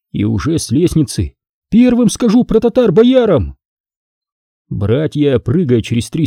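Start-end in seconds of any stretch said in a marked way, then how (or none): 1.7-3.6 put-on high boy's voice, shouted down the stairs; boy calling back. Boy calling from the stairs to his brothers, boastful shout. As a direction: boast it as you run off.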